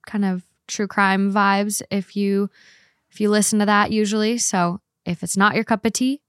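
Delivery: usual speaking voice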